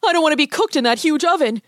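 put-on high, fearful child's protest; panicked boy. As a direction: whine with dread to your sister. Panicked boy dreads the oven.

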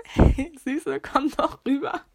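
lightly voiced cocky boy calling out; catcaller.

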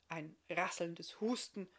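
plain reading voice

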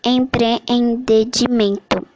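"empreendimento" slowly split em - pre - en - di - men - to; "empreendimento" drawn out syllable by syllable. Shown as a demonstration. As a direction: sound out each syllable of "empreendimento". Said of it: "a divisão silábica como este".

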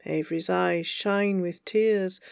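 usual speaking voice

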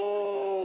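high, soft drawn-out "ooh"; fake stretching groan. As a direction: imitate a casual stretch with a high moan. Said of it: "I do the thing where I stretch".

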